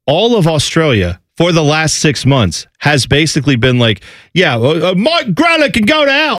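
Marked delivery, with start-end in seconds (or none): 4.3-6.4 a rough, hammed-up Australian accent, pitched up on the curse; half-botched Australian twang, mocking the fans. Mocking Australian fans in a botched Australian accent. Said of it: "I don't even know what accent that is".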